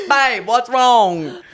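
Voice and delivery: slow, drawn-out mock British accent; attempted British accent. Retelling the coach's question to a nervous athlete, hammed-up British accent. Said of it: "Or whatever British accent is".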